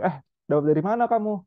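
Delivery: sharp, high accusing demand; intimidating. Shown as a demonstration. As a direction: corner the child with the question. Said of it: "langsung mengintimidasi gitu".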